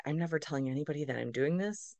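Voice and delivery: low and hushed; embarrassed secret thought. Retold or imitated. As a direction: confide it softly, as if to yourself.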